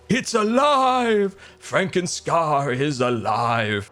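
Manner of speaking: slow, drawn-out mock mad-scientist cry. Streamer hamming a mock mad-scientist cry over himself. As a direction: ham up the triumphant creator.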